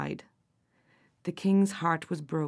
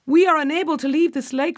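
calling out; 7 semitones higher, 6 dB louder than usual. Acted. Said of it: "said Fionnuala".

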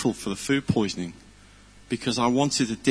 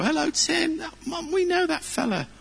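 put-on much higher, childlike greeting; imitating the kids. Preacher mimicking kids on the road spotting him, high childish voice. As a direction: pipe it up like an excited little kid calling out. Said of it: "the kids would go".

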